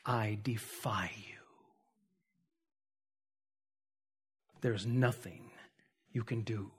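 low, very soft, measured refusal; grave acted defiance, barely voiced by the end; hushed defiance. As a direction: defy them quietly, sinking almost to a whisper. Acted defiance, sinking very quiet.

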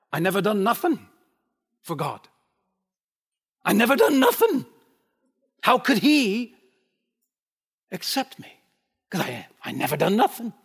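put-on old broken Scottish. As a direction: voice a poor dying Scotsman in broken Scottish. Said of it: "in his old broken Scottish".

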